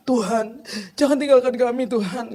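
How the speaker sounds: high-pitched imitation of a weeping prayer; mock tearful pleading. Preacher imitates a congregant's intercession prayer, mock crying. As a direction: wail it pleadingly, as if crying to God. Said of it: "gitu, nangis-nangis".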